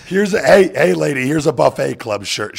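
loud, high, mocking shout at the lady; shouting down the faking woman; mock yell. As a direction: bark it at her like a bouncer fed up with her.

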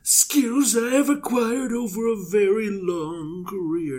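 half-serious mock Taken menace; Liam Neeson impression. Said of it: "to Liam Neeson from Taken".

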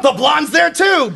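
high, drawn-out shout; exclaiming. Real, not acted. Mock outrage over group photos.

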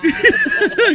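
a real laugh, pitched high; high burst of laughter. Real, not acted. Laughs at the caller.

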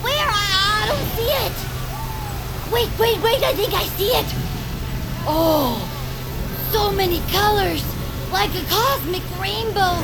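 thrilled stargazer shouting; excited wonder. Acted. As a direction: gush like a child at fireworks. Sees a comet in wonder.

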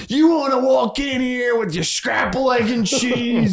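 yelling coach. Retold or imitated. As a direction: bark it like an angry coach. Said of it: "yelled at us".

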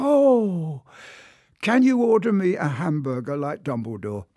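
put-on high, loud, eager child's plea; a wide-eyed kid begging a favour; excited little boy. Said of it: "He said".

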